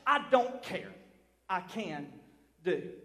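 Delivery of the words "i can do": much softer and lower, slow; sudden hush. Acted. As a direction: drop the voice to almost nothing. Repeated softly after shouting.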